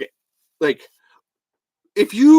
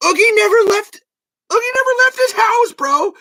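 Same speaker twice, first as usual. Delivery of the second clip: high, loud, rushed outburst; exasperated shout; 4 dB louder than usual. Real, not acted. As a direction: blurt it in high-pitched frustration. Heated rant peak.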